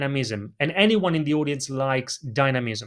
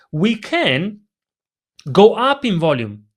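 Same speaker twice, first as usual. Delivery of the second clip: words pushed out louder; 4 semitones higher, 5 dB louder than usual. Shown as a demonstration. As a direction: boost the volume on this phrase.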